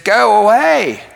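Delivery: high, loud, drawn-out mock yell; retold drawn-out shout; angry rebuff. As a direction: act out an upset girl driving him off, slowly and loudly. Same rebuff, acted a second time.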